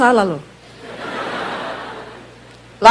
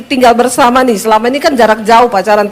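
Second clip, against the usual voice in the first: usual speaking voice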